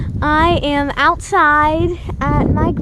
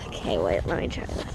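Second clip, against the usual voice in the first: whispered to herself; whispering; 12 dB quieter, faster than usual. Real, not acted. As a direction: breathe it out under your breath. Mutters to self trying shot.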